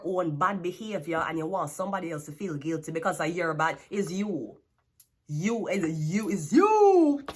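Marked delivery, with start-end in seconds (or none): 5.3-7.2 loud, slow, each word hammered; scolding, slow and loud; slow emphatic blame. Real, not acted. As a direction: drag it out and pound every word.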